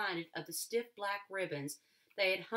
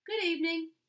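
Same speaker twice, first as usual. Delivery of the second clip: a high, loud called greeting; a stranger greeting a girl from the gate, called out high and loud; calling out; 10 semitones higher, 7 dB louder than usual. A narrator's reading. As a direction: call across the yard to the girl. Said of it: "he called out".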